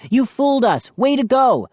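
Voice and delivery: high, falsely jolly pleading; high, strained good humor; forced cheer. A narrator's reading. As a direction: play along, high-pitched and eager. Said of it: "forcing a hoarse laugh".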